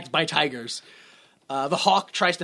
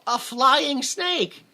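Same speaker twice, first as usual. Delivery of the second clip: higher, very slow deadpan; incredulous; 8 semitones higher, much slower than usual. Real, not acted. Telling the weird plot, slow incredulous. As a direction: drag it out in disbelief. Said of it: "Uh, also".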